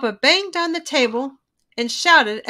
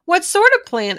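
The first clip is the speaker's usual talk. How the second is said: lightly voiced little sister; 6 semitones higher, 3 dB louder than usual. A narrator's reading. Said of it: "asked Sister".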